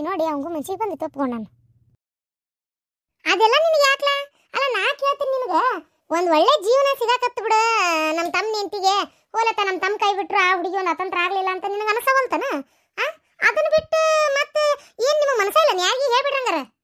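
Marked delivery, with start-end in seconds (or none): 3.2-13.0 raised, higher-pitched indignation, pressing her point; high indignant rebuke. Acted. Mother-in-law rebuking a prying relative, high indignant voice. 13.5-16.7 tearful protest. Acted. Upset pleading with relatives.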